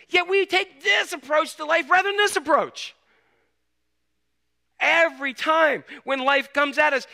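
loud, high-pitched preaching push; forceful preaching. Acted. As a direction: preach it out to the room with full force.

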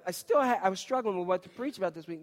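ordinary delivery

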